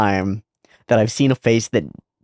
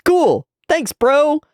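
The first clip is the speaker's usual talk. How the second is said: much higher, bright cartoon bro; 3 dB louder, much slower than usual. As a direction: toss it off in a squeaky voice. Voicing Yuji in the scene.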